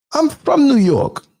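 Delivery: slow, hammed-up New York accent, pitched high; mock New York accent. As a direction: overdo the fake New York claim. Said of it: "she was trying hard".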